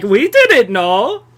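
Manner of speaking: slow, drawn-out mock German accent; stretched German accent with a swooping tune. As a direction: imitate a German feigning innocence. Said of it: "That's my German accent".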